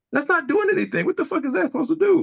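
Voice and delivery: sky-high exasperated outrage; exasperated outburst. Real, not acted. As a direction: voice leaps way up in disbelief. Angry rant about furlough.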